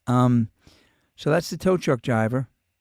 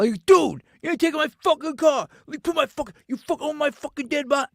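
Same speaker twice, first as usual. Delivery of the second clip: much higher, loud tough-guy yell; imitated yelling; 14 semitones higher, 5 dB louder than usual. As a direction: bellow it like a Long Island hothead guarding his car. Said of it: "like, people yell".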